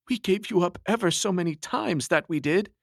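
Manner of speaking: high, relieved friend's voice. Acted. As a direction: pour out relief, a touch choked. Tom relieved at friend's recovery.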